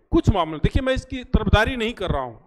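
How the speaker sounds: normal talking voice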